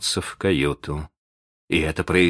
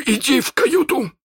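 iron voice; 3 dB louder than usual. Acted. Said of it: "своим самым железным голосом".

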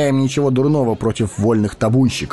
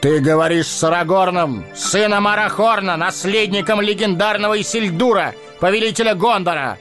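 booming, high, commanding proclamation; thundering voice; 14 semitones higher than usual. A narrator's reading. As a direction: announce your royal lineage. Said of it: "прогремел его голос". (громовой голос)

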